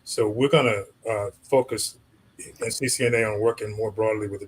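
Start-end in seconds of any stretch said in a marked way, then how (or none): none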